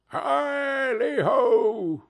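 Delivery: long, high, drawn-out halloo; hearty old master's stretched cry; jolly holler. A narrator's reading. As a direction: sing out a merry rallying call. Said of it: "cried old Fezziwig".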